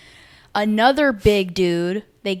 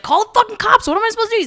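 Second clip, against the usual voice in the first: panicked shout; 13 semitones higher, 4 dB louder, faster than usual. Retold or imitated. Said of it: "he's just like".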